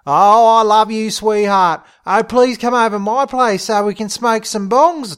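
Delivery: high, loud teasing lovey-dovey voice; mock wooing. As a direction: tease him with over-the-top sweet talk. Dingo teases friend with mock wooing.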